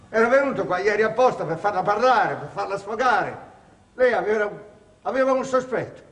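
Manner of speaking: high, loud, urgent insistence; heated pleading. Acted. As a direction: press the point hard and fast. Heated self-justification to the signora.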